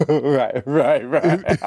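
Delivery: real laughter breaking through the words; laughing. Real, not acted. Host reacting to friend's caviar comparison, laughing. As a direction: agree while cracking up.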